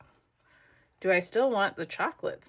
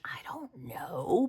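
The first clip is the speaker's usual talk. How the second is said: whisper; 5 dB quieter, slower than usual. Real, not acted. Aside to herself while crafting.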